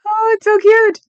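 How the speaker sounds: high, loud squeal of delight; delighted squeal at the picture; gushing. Real, not acted. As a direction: coo over how cute it is. Gushing over a photo.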